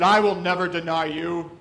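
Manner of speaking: loud proud boast; Peter's loud vow; mock boast. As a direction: declare it loudly, puffed up. Peter's boast retold in sermon.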